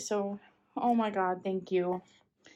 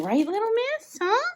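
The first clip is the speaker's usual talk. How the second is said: high coaxing voice to a cat; pet talk; 13 semitones higher, 4 dB louder than usual. Real, not acted. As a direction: coo up high at the kitty. Talking to her cat.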